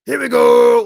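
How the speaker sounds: loud, high-pitched holler; imitated catchphrase shout. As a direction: belt out a quarterback's call in imitation. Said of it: "it'd be so funny 'cause he says".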